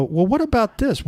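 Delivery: high, tossed-in question; a mock cut-in, pitched high; mock interjection. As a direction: jump in with a quick question. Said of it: "and you go".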